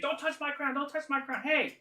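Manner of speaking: high, repeated indignant warning; guard your treasure in a high monster voice; crown-guarding creature. Acted. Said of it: "He's like".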